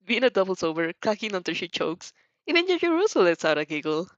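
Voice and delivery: ordinary delivery, nothing marked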